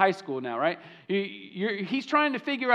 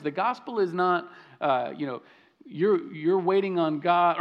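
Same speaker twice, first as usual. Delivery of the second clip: ordinary talk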